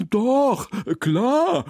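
high, loud, eager delight. A narrator's reading. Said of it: "freut sich Herr Mommsen". (hocherfreut, hoch und laut)

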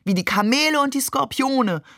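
high-pitched chiming in; calling out. A narrator's reading. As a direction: call it eagerly from the side. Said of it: "rief nun einer meiner Cousins".

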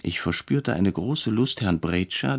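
plain reading voice

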